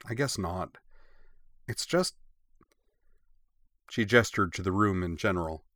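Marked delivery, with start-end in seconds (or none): 0.0-2.2 sighed concession, then a hanging rise; resigned sigh. A narrator's reading. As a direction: give in, then trail upward unfinished. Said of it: "sat back down with a heavy sigh".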